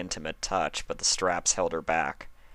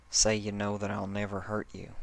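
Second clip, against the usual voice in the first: soft insistence; 2 semitones higher, 4 dB quieter than usual. Acted. Applejack insists softly.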